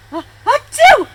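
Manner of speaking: very loud, very high sneeze; a big sudden monkey sneeze; explosive acted sneeze. A narrator's reading. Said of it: "began to sneeze".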